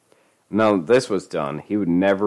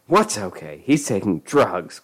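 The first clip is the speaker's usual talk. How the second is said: ordinary delivery, nothing marked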